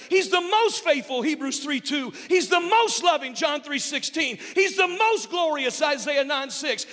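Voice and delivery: rising, ringing call of praise titles; preaching litany. Acted.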